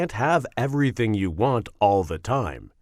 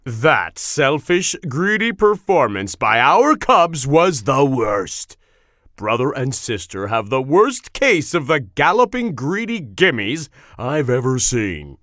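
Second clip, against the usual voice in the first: deep-voiced angry Papa Bear; roaring; 5 semitones lower, 3 dB louder than usual. A narrator's reading. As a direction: thunder on like an outraged father. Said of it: "he roared".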